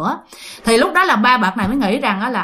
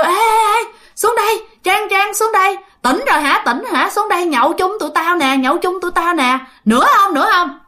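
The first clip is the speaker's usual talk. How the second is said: calling up; 6 semitones higher than usual. Retold or imitated. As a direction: holler up to a friend on the second floor. Friends calling up to balcony.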